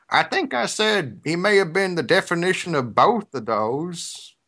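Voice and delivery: drawling the correction like the mayor; mayor's drawl. Retold or imitated. Mayor correcting the reporter in a slow Southern drawl. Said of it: "He said".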